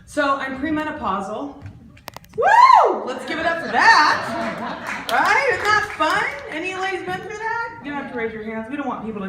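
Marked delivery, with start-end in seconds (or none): 2.4-3.0 high, loud sarcastic woo; mock celebratory whoop. Comic cheering her own menopause to the crowd. 3.0-6.5 sarcastic hype. Acted. Sarcastic cheer to crowd.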